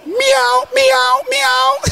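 high, teasing meows; mock the men like cats. Preacher teases the men with high cat meows.

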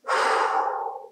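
long, audible breath; deep breath. A narrator's reading. Flustered Layla before explaining herself, deep breath. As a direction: breathe deep to gather yourself. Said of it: "Layla took in a deep breath".

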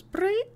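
high squeaky beep sound; device beep. Retold or imitated. GM imitating the backpack device beep, high squeak. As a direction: chirp it like a little machine. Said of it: "it goes".

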